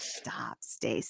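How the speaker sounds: low, hushed whisper; imitating a shy, soft protest; bashful mock whisper. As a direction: whisper it coyly, barely any voice. Florian's bashful reply, acted out.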